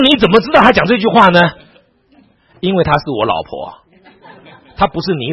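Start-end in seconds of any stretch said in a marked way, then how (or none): 0.0-1.4 high, loud incredulous question; hammed-up loud disbelief; baffled demand. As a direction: mimic a friend who can't believe it. Retold friend questions him, baffled.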